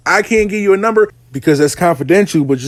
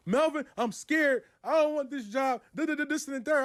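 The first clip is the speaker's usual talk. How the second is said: mock scared client; 9 semitones higher, 14 dB quieter than usual. A client telling Melvin she is scared of the new job, whiny. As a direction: imitate her nervous hand-wringing.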